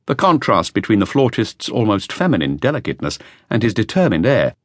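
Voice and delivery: usual speaking voice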